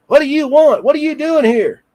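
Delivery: high-pitched mock yell of an old nurse; rude yelling, imitated. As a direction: imitate a cranky elderly nurse yelling at intruders. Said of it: "she starts yelling at us".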